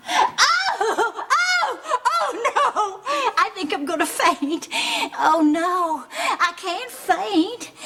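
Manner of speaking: high, faint-headed cries of alarm; panicked wailing. Acted. Mother finding Tom gassed by the oven, panicked wail. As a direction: wail as if about to swoon.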